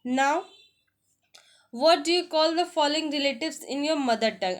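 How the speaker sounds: slow, careful English read-aloud; slow textbook reading. Shown as a demonstration. Teacher reading the family lesson to students, slow and deliberate. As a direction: spell it out slowly for learners. Reads activity question.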